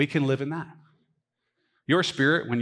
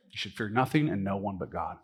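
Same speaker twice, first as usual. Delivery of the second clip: lower, softer echo of the line; soft sincere repeat; 5 semitones lower, 6 dB quieter than usual. Acted. Pastor repeating the verse to the church, soft and sincere. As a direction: let it land gently, almost to yourself.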